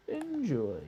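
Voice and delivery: high, falling sing-song "Enjoy"; sing-song mock enjoy. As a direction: toss off a high mocking "Enjoy". Repeats enjoy, second time mocking.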